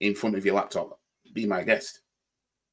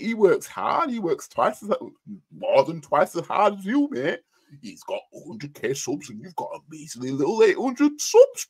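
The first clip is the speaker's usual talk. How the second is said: high, preachy mock scolding; prim and proper mock; 7 semitones higher, 3 dB louder than usual. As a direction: wag a finger like a smug defender. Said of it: "In that prim and proper".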